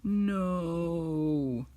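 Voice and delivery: hammed-up grief cry of a kid playing Po; anguished cry. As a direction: wail over the fallen master. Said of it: "And he's like".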